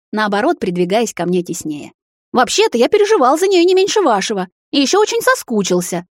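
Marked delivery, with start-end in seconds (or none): 2.3-6.1 high-pitched indignant whine; offended. A narrator's reading. Brother defends his place by his sister, offended. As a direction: plead your case, voice up high. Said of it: "обиженно буркнул Кай".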